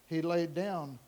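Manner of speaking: usual speaking voice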